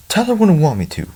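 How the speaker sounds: high-pitched narrated cry; restrained scream. A narrator's reading. Father cries out after the thrown bear, restrained scream. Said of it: "Robert screamed after the bear plummeted".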